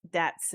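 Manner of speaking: "dats" with a hard d for th; "dats" the Cajun way, starting on d. Shown as a demonstration. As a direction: say "dats" with a d where the th would be. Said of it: "they speak a lot of "dats".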